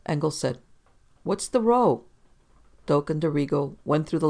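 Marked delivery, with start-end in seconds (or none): 1.2-2.0 calling out. A narrator's reading. Assistant calling out at commotion.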